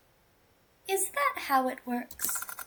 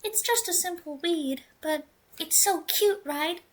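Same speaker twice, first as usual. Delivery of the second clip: put-on higher, sweet girl voice; right character voice; 6 semitones higher than usual. Redoing the girl's line in the proper higher voice. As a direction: redo it in the cute girl voice. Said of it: "Oh, wrong voice".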